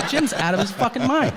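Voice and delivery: laughing at the absurd friend. Real, not acted. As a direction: crack up at the punchline. Punchline with laughter.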